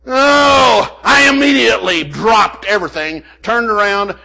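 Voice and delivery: loud, high burst of retold panic; loud mock panic; panicked exclaim. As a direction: blurt it out like you just dropped everything. Recalls panic at audit letter.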